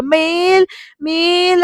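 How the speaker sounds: high, drawn-out call. Retold or imitated. Jayanti running downstairs calling out, high stretched call. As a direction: draw the name out high.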